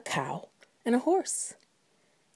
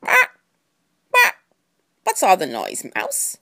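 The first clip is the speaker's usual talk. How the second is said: lightly voiced chicken with a squawk; squawk, then question the mouse; 4 dB louder than usual. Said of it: "And the chicken said".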